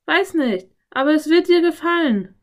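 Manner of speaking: high, loud, eager call; calling back. A narrator's reading. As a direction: shout back to coax someone outside. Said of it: "rief sie zurück".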